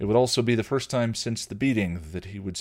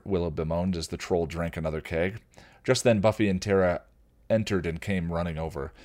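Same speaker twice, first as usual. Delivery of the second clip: ordinary delivery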